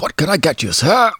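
high-pitched mock Old English server; Old English accent. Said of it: "It's, like, Old English".

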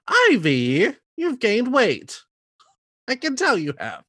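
high, loud, slow mock stage mother; hammed-up disapproving mother, high and slow; Bernadette Peters as mean mom. Podcast host imagining Ivy's mother, high mock scolding. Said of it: "imagine Bernadette Peters being like".

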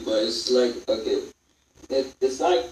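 ordinary talk

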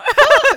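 shrieking laugh, very high and loud; loud high shriek of laughter. Real, not acted. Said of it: "Oh, Léna!".

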